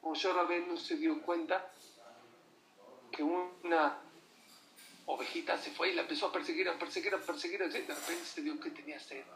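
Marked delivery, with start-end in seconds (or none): none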